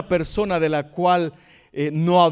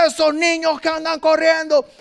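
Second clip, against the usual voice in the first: high, indignant complaining cry; mock outrage at the running kids; making a scandal; 9 semitones higher than usual. Usher complaining to the pastor about running children, high and indignant. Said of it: "hacía todo un gran escándalo".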